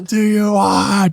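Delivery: high, drawn-out mock-dramatic demand; movie quote. Topping the co-host's Notebook quote, hammed-up and slow. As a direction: stretch it out like overblown film melodrama. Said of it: "Or The Notebook".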